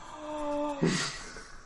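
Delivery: high, startled gasp; sharp high gasp. Acted. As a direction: gasp in shock. GM acts NPC gasping.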